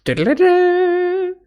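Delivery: high, slow mock trumpet trill; sung trumpet imitation; imitating trumpets. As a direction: toot it like a little trumpet riff. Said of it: "the trumpets are doing like".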